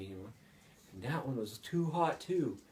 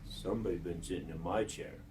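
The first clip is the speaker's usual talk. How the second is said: lightly voiced low father bear; low, restrained bear grumble; Papa Bear; 5 semitones lower, 6 dB quieter, faster than usual. A narrator's reading. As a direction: drop the voice low for the big bear. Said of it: "And Papa Bear says".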